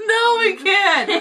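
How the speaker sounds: high, loud, slow through laughter; real crying laughter; laughing till crying. Real, not acted. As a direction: gasp it out helplessly laughing. Laughing so hard she cries.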